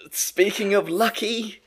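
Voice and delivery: high, slow, laughing through it; slow giggling segue. Real, not acted. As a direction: stretch it out while cracking up.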